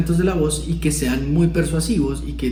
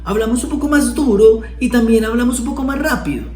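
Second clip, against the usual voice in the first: higher, tense and hurried; frightened voice demo; 7 semitones higher than usual. Shown as a demonstration. Teacher shows fear in the voice, pitched up. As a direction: speak as if something scares you. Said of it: "cuando estamos asustados".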